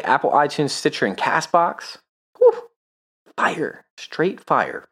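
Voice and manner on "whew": sharp high whistle of relief; high whew. Real, not acted. Reaction after the bourbon.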